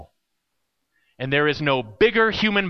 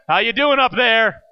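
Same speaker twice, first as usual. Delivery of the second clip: louder, higher-pitched call upward; imitated yell; 4 semitones higher, 5 dB louder than usual. Hank halfway down calls up to camp leader, imitated yell. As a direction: holler it up as if from below. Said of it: "I hear Hank yell".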